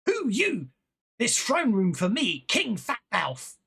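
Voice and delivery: high, loud, gruff goblin king in broken speech; a petty goblin king bossing intruders about. Acted. Goblin king demands to know who barges in, loud and high. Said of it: "and goes".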